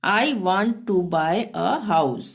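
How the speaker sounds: each English word said very slowly and clearly; slow dictation. Shown as a demonstration. Teacher dictating the English sentence, very slow. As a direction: spell it out slowly for learners. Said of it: "अंग्रेजी में".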